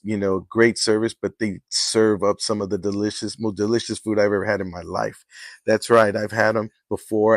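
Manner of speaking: host reading a sponsor ad, smooth and soft. Acted. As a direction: sell the caterer like a radio spot. Sponsor ad read.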